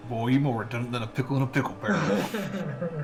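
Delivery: lightly put-on drawling voice, laughing; quoted video line. Quoting a favourite video line in a mock voice. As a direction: drop into a hammed-up twang. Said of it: "that one".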